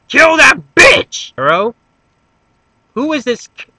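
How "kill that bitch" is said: hyped shout. Acted. Hyped up about fighting Kero.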